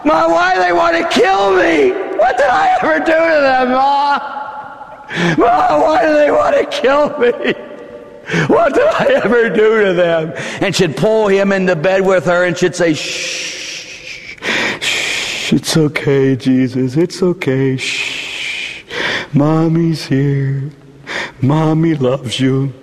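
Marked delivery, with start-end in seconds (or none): 0.0-10.3 put-on high, pleading little boy; frightened child voice. 12.9-22.7 put-on rock a child back to sleep; rhythmic shushing. Mother soothing son.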